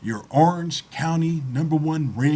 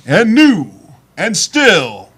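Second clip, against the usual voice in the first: ring announcer call; 6 dB louder, slower than usual. Shown as a demonstration. Announcing his winners to the crowd.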